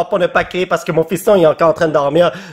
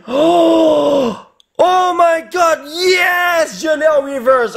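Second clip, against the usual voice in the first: excited shout; 11 semitones higher, much slower than usual. Real, not acted. Pulls a card he loves.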